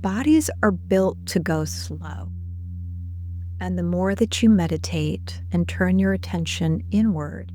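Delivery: unhurried, measured guided-visualization voice; guided meditation. Acted. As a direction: lead listeners gently inward, leaving space between phrases.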